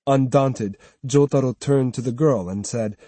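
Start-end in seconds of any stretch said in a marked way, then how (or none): none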